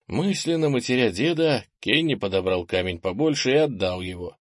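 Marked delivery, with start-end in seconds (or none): none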